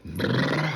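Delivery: trilled "brrr" of a truck pulling away; vocal engine rumble; imitated engine noise. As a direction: roll a motor sound with the mouth.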